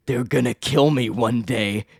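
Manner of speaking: slow, melodramatic villain voice; Ezekiel. Retold or imitated. Villain answering his concerned assistant, slow mock-dramatic villain voice. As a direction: mimic an overwrought villain, drawn out. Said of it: "and he's like".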